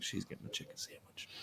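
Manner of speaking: whispered aside to himself; breathless whispered thought. Retold or imitated. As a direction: hush it like a secret realization. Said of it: "I was like".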